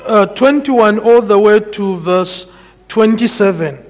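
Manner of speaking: measured sermon delivery, weighty and deliberate; preaching. Acted. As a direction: preach it to the congregation. Preacher expounding scripture.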